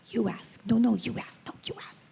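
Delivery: whispered, nudging back and forth; shy whispering girls. Retold or imitated. Two little girls whispering, each pushing the other to ask. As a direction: hush it like a shy child.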